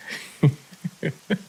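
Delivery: short high-pitched chuckle; amused little laugh. Real, not acted. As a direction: chuckle at your own joke. Laughs at own joke.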